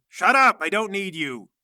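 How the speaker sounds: loud, high "shut up" that eases slightly; imperious shouted dismissal; angry outburst. Acted. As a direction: cut them off sharply and loudly. Madam snaps at her objector.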